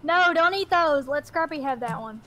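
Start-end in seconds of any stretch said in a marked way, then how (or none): none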